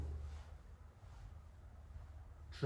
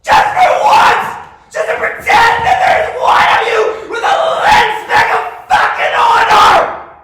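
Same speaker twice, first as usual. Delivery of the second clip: all-out furious scream, much higher and louder; enraged screaming tirade at his audience; screaming rage; 27 dB louder than usual. Real, not acted. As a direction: scream it at them with everything you have.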